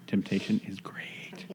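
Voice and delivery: soft, slowed-down whispery delivery; hushed, low, lingering repeat; slow, savoring. Real, not acted. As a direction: draw it out slowly and quietly, pitched low. Repeats the line slowly, softly.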